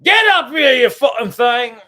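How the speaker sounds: angry mock British shout, high and loud; British accent. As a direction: yell it like an irate Brit. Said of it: "the energy I would have to assume".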